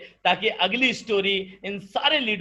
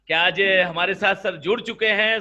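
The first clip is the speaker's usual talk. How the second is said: ordinary delivery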